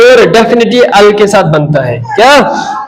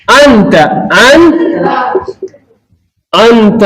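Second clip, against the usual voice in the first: slow model word; much slower than usual. Shown as a demonstration. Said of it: "दूसरी मिसाल".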